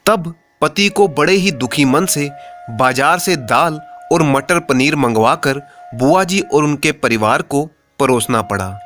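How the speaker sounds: ordinary delivery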